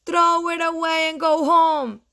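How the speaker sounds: high, loud chanted dismissal; robins' loud taunt; jeering robins. Acted. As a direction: call it out high and loud as mean birds. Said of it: "said the robins".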